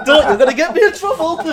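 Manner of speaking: high, loud protest through laughter; cracking up, voice shooting up loud; real laughing protest. Real, not acted. Joking host begs co-guests not to land him in trouble, laughing. Laughing after Glasgow remark.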